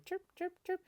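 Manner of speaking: high chirping bird calls; bird chirps. Acted. Teacher voicing city birds in the story, high chirps.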